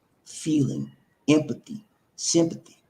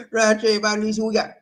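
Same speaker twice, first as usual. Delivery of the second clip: ordinary delivery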